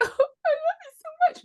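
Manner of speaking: very high, squeaky sentimental wail; imitating a tearful, doting parent; mock weepy gushing. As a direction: gush it like a sobbing parent, hammed up.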